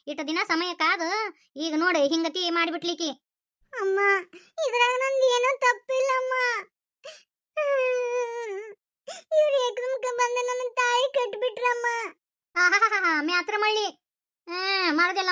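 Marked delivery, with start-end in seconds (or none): 3.7-11.9 high, slow, pleading through tears; tearful plea. Acted. Bride pleading with her mother, tearful and slow. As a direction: beg her mother to believe her.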